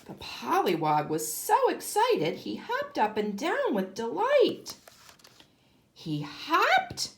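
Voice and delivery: usual speaking voice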